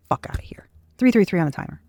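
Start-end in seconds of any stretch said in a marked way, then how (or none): 0.1-0.7 a scornful whispered dismissal. Real, not acted. Brushing off the whole idea, under her breath in a scornful whisper. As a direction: breathe it out with contempt. Dismissive aside after a rant.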